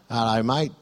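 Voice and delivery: lightly put-on Australian accent; casual Aussie greeting, only lightly imitated. Australian caller greets the pastor by phone, in a light Australian accent. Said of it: "a bloke with an Australian accent".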